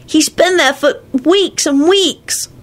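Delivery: put-on a kid grumbling about a pest; sulky child, high and drawn out; high child voice, annoyed. Teddy voice.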